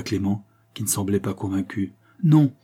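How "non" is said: sharp, scornful no, pitched high and loud; disdainful. A narrator's reading. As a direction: cut him off with contempt. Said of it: "interrompit dédaigneusement".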